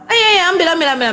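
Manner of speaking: high-pitched, repeated urging in a retold quote; eager urging. Ustadz tells Nora to take the mukena, eager urging. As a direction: imitate someone hurriedly offering, pitched well up.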